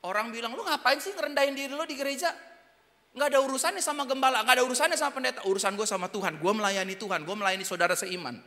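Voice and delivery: loud, fast insistent preaching; passionate preaching. Acted. Preacher to congregation, loud passionate preaching. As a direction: drive it home to the crowd.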